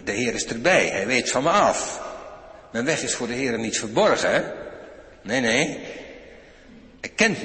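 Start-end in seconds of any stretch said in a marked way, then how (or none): none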